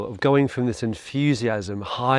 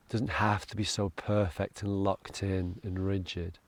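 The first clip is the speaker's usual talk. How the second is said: soft, lowered meditation voice with long pauses; guided meditation; 3 semitones lower, 8 dB quieter than usual. Acted. Meditation leader settling listeners into three deep breaths, soft and low. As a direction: ease the listener into calm, softly and unhurriedly.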